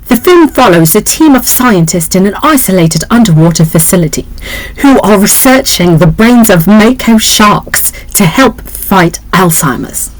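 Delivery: put-on a light British accent, reading the synopsis. Reading the movie blurb aloud in a mild British accent. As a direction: read it like a British scientist. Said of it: "so I did the British, British accent for the, the main scientist".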